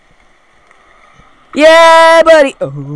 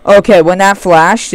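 ordinary talk, nothing marked

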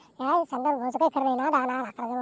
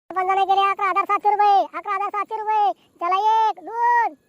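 auctioneer's call; 7 semitones higher than usual. Acted. Auctioneer announcing the sarpanch's bid, calling out. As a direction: sing out the bid and count.

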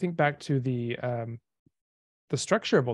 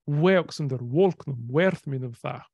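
put-on Old English recital. Shown as a demonstration. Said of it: "Just the first part".

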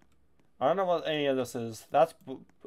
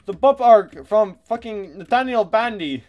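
excited shout; 7 semitones higher, 9 dB louder than usual. Real, not acted. Loud excited game comment.

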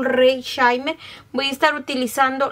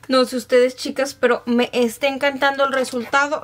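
ordinary talk, nothing marked

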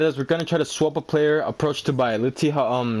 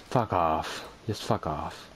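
much lower, dropped almost to nothing; muttered curse; 12 semitones lower, 9 dB quieter, slower than usual. Real, not acted. Gamer muttering at a taunting congratulation message. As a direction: grumble it under the breath.

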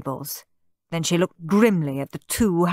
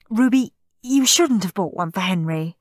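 ordinary delivery

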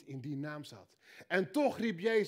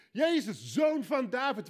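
crying out; 6 semitones higher, 6 dB louder than usual. Acted. Bartimaeus crying out.

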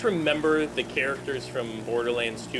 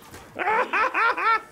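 high, loud burst of laughter; 5 dB louder than usual. Real, not acted. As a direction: crack up laughing. Laughing at gameplay.

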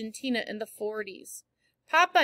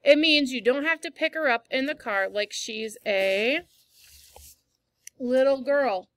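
stern; 2 semitones higher, 4 dB louder than usual. A narrator's reading. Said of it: "he said sternly".

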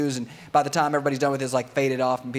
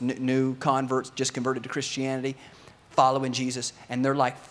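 ordinary delivery

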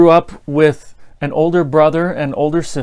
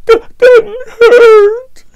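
much higher, louder, tearful wail; crying denial; 9 dB louder than usual. Retold or imitated. The crying sister insists to her dad it doesn't hurt, high tearful wail. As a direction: sob it out while still denying. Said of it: "you could see tears rolling down her face".

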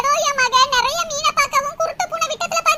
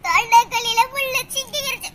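put-on pained girl with bone in throat; 6 semitones lower than usual. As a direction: beg weakly, voice low and hurting. Same girl calls mother. (தொண்டை வலியில் தாழ்ந்த குரலில் கெஞ்சும் பெண்)

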